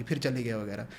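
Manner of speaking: normal talking voice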